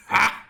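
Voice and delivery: sudden high squeal of surprise; a quick high shriek as it goes wrong; startled yelp. Real, not acted. Builder startled when the block misfires, high yelp.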